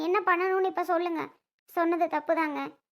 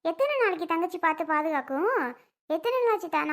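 ordinary delivery

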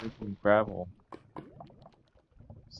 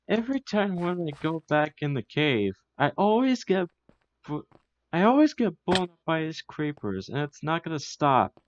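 being chased; 6 semitones higher, 4 dB louder than usual. Real, not acted. Fleeing creepers in the cave.

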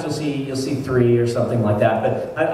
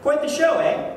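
lightly voiced Southern pilot, higher and chirpy; southern accent; 12 semitones higher than usual. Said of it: "She had a southern accent".

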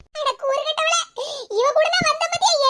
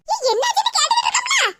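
higher, fast, laughing call; impatient shout; 7 semitones higher, faster than usual. Acted. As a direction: snap at her to answer quickly. Neighbor impatient, demands answer.

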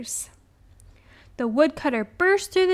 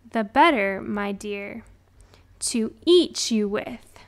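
ordinary delivery, nothing marked

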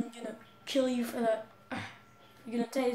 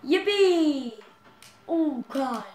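excited; 5 semitones higher, 11 dB louder, much slower than usual. Real, not acted. Cheering a trick.

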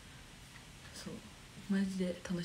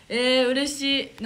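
delighted; 4 semitones higher, 10 dB louder, slower than usual. Real, not acted. Reacting to fan compliment.

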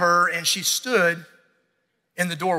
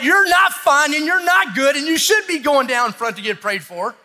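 higher, emphatic friendly push; urging, raised voice; 6 semitones higher, 4 dB louder than usual. Retold or imitated. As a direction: insist they go get prayer. Spouse nudging you to confess.

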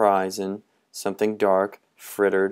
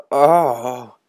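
gasp; 4 semitones higher, 7 dB louder than usual. Acted. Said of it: "gasped everyone".

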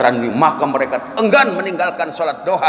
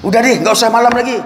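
loud, high, quick protest; exasperated giving up; 7 semitones higher, 5 dB louder, faster than usual. Acted. Drinker tells Umar to skip nights, exasperated protest. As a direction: blurt it out fast, fed up.

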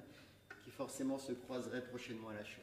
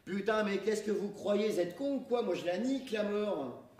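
loud, high defiant yell; defiant shout; 6 semitones higher, 6 dB louder than usual. Acted. JP yells down to his stunned friends, loud and defiant. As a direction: bellow it down at the crowd. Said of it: "il hurla".

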